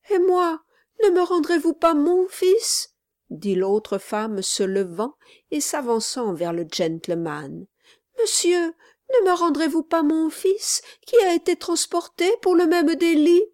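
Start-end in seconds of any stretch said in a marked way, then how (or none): 0.1-2.9 much higher, crying mother's plea; a mother breaking into tears as she begs; pleading mother. A narrator's reading. Other mother begs gentleman for her son, high and tearful. 8.2-13.4 high, breathy tearful plea; begging mother. A narrator's reading. Mother pleads with gentleman for transported son, high tearful whisper.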